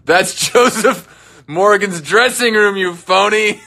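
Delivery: playful accusation, laughing; laughing mock scold. Real, not acted. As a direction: call them a phony through laughter.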